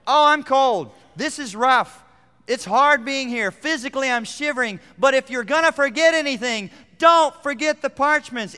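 high, loud pleading apostle; Paul's voice. Retold or imitated. Imprisoned apostle begging friend not to forget parchments, loud plea. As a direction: beg as the shivering prisoner. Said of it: "It's almost like".

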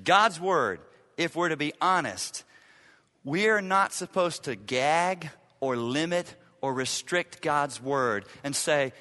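ordinary talk, nothing marked

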